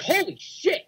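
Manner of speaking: high-pitched mock astonishment; imitated shock. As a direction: act out a startled reaction, pitch leaping up. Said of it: "And then Aaliyah's like".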